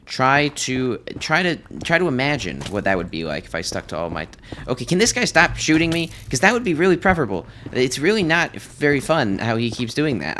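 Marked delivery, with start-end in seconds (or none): 4.7-10.4 annoyed complaint. Real, not acted. Getting shot by a piglin.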